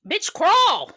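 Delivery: high, loud yelled order; exasperated shout. Acted. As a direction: yell at the helpless movie victim. Yells at victim in movie.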